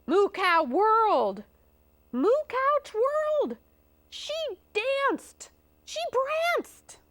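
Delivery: high, bouncy, punched phrases; sing-song, very high storytelling; excited narration. A narrator's reading. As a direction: narrate each move as a rising thrill. Cow's wild dance builds.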